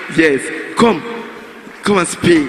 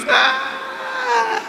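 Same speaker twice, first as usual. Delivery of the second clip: high joyful scream of delight; excited scream; 14 semitones higher, 8 dB quieter than usual. Real, not acted. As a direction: let out a shrieking cry of joy. Overjoyed winner thanking fans.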